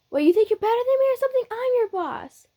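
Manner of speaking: very high, fast, indignant boast; cocky brash fighter. Acted. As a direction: pull rank in a high, rushed voice.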